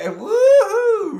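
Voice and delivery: high-pitched "woohoo" cheer; loud whoop. Real, not acted. Cheering the viewers who joined, loud high whoop.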